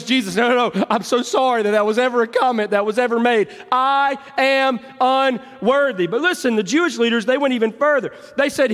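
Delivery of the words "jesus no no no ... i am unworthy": higher, rushing then slowing on unworthy; flustered apology. Retold or imitated. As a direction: apologize in a hurry, mortified. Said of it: "he goes".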